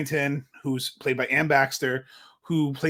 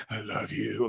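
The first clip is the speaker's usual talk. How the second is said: lower, much softer obsessive murmur; classic creepy love; 6 semitones lower, 8 dB quieter than usual. Retold or imitated. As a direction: say it like a stalker. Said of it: "Like the very classic".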